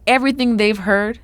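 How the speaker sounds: measured build to an emphatic, suspenseful reveal; dramatic story narration. A narrator's reading. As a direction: narrate it like a tense sports tale, with dramatic pauses. Reads a dramatic story passage aloud.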